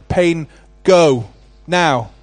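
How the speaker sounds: retold command in short, loud bursts; commanding prayer. Children praying over their mother's knee, retold as a firm command. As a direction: order the pain out, one word at a time. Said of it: "tell this pain to go".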